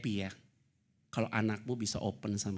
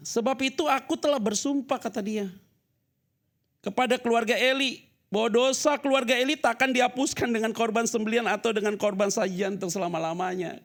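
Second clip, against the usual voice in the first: loud, high-pitched preaching; preaching at full force; 10 semitones higher, 8 dB louder than usual. Acted. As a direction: thunder it out to the congregation. Reads scripture at full force. (khotbah berapi-api dengan suara keras dan tinggi)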